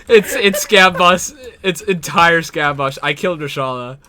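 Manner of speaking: high, loud, stretched-out report; excited shouting. Real, not acted. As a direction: yell the news, easing off at the end. Reports the kill amid firefight.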